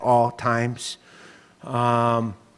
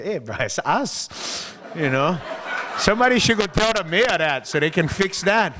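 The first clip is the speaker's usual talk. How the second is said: joking to a friend; 6 semitones higher than usual. Retold or imitated. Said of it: "I look at Jay".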